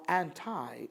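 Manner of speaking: anti said as "AN-tie"; variant pronunciation. Shown as a demonstration. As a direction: end anti on a long "eye" sound. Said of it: "the Greek word anti".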